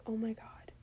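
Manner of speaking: breathless, hushed oh my god; shocked whisper. Retold or imitated. A fan gasping at the TV in hushed shock. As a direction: gasp it under your breath in shock. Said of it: "I was like".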